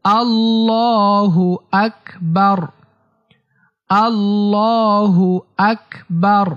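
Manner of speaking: আল্লাহু আকবার slow, with a long held "llaa"; repeat-after-me takbir. Shown as a demonstration. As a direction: hold the vowel in আল্লাহু, pause, then আকবার. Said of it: "আমার সাথে সাথে বলেন".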